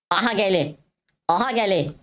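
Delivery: high, quick alarmed call. Real, not acted. Gamer sees enemy coming, high alarmed call.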